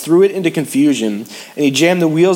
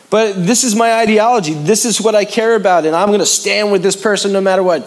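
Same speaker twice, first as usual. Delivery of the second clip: much higher, louder insistence; higher, stubborn protest; defiant believer; 7 semitones higher, 3 dB louder than usual. Acted. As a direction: plant your feet and defend it.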